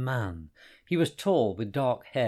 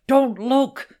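high, loud snarled warning; 13 semitones higher, 13 dB louder than usual. A narrator's reading. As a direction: bark the warning to save his friend. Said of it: "snarled Hassan".

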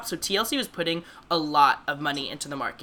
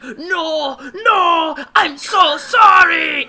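high, loud, drawn-out pleading cry, slow on the apology; pleading victim; 9 semitones higher, 11 dB louder, much slower than usual. Retold or imitated. Retelling the horror movie to cohost, imitating the victim's shrill pleading. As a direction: beg like a terrified prisoner, hammed up. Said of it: "the guy is like".

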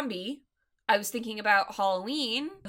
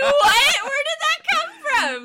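very high, loud laughing disbelief; baffled outburst; 9 dB louder than usual. Retold or imitated. Podcaster reacting to the random compliment, laughing bafflement. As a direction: shout it incredulously through laughter.

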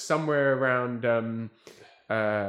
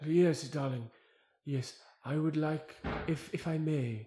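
grand, slow, higher British thespian voice; theatrical luvvie producer; 4 semitones higher than usual. Retold or imitated. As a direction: play a gushing old theatre darling. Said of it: "I'm gonna give him this voice".

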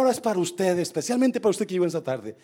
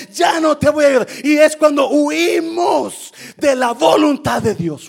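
full-force preaching; 8 semitones higher, 8 dB louder than usual. Real, not acted. Preacher reading Paul's vision to the church, full-force preaching. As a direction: preach it loud and high, stretching key words.

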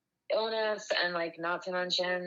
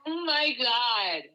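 laughing; 6 semitones higher, 5 dB louder, slower than usual. Real, not acted. Laughing at the crude quote.

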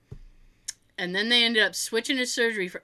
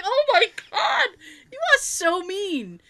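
high-pitched retold shock; scandalized; 10 semitones higher, slower than usual. Child reacting to mother's cruelty, high scandalized disbelief. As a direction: squeal it in mock horror, drawing out the end.

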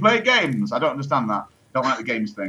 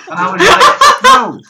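squealing laugh through the word; high, loud laughing no; 12 dB louder, much slower than usual. Real, not acted. Laughing recalling own reply.

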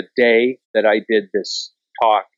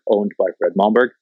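ordinary talk, nothing marked

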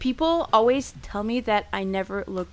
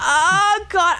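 high, louder retold wail; mock sick moan; 8 semitones higher, 3 dB louder than usual. Drunk woman replying to her roommate after puking, mock wailing. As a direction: groan it out in drunken misery.

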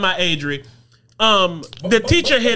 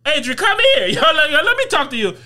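retold parent calling child; 6 semitones higher than usual. Parent retells calling child over.

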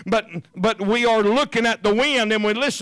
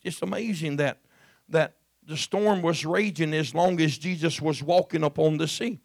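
normal talking voice

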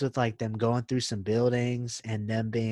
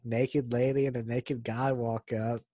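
ordinary delivery

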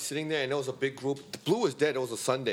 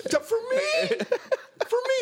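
very high, shouted mock amazement; mock delighted shout. Imitating Sean's overjoyed surprise at the party, shouted high. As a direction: shriek it like an overjoyed host. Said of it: "And then you're like".

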